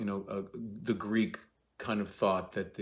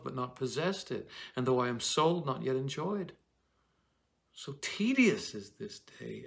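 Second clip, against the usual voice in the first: dramatic reading; 4 semitones higher than usual. Acted. Actor reading Juliet's lines with longing. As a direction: recite Shakespeare with feeling, a little higher. Reads Juliet's speech aloud.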